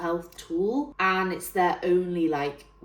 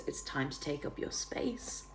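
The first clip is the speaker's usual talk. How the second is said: whisper; 3 semitones lower, 11 dB quieter than usual. Acted. Whispered tagline.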